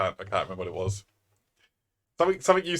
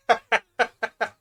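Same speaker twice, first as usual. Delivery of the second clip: loud laugh; 13 semitones higher, 6 dB louder than usual. Real, not acted. Laughs at own rambling.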